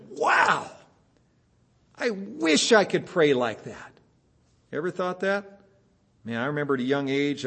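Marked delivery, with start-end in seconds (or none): 0.1-3.9 high awed gasp of a wow, then slow wistful line. Retold or imitated. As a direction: sound amazed by someone's prayer. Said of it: "we've sat back and said".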